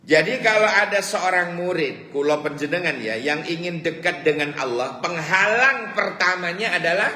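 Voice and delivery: high, loud sermon delivery building up; fiery preaching. Acted. Preacher warning the congregation about forbidden food, fiery and loud. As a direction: preach it out to the whole hall.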